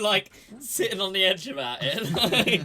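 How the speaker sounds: high, loud teasing laugh. Real, not acted. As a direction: tease through laughter.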